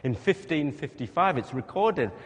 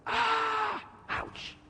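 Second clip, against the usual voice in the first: mock cry of pain. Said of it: "I'd have gone".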